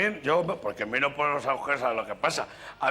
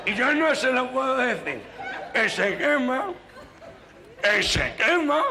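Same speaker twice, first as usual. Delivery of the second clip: much higher, laughing nasal-voiced fretting; nasal stammerer; 9 semitones higher than usual. Nasal passenger can't warn the driver, hammed-up nasal fretting. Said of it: "compañero gangoso".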